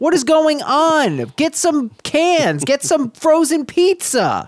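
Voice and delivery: high, slow, outraged demand; riled up. Real, not acted. Host scolding the author about an empty house, riled up. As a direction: wind yourself up at the bare cupboards. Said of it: "when you get riled up".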